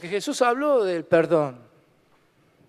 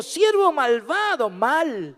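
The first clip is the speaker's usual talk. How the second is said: stern rebuke; 7 semitones higher, 4 dB louder than usual. Acted. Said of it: "llamándole su señor le dijo".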